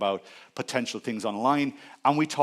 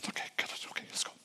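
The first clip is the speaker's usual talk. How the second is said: sigh, then whisper it to yourself; whispered psyching-up after a heavy breath; whispered self-talk; 13 dB quieter, much faster than usual. Acted. Acts out bracing himself to help.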